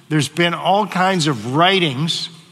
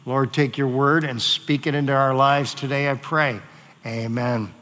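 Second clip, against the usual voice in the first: softer, hushed closing prayer; quiet prayer; 5 semitones lower, 5 dB quieter than usual. Acted. Pastor closing the scripture reading in hushed prayer. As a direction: pray it softly over the congregation.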